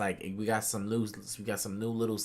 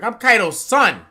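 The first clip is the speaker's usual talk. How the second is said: retold Yamato's shout; 11 semitones higher, 15 dB louder than usual. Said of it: "And she was like".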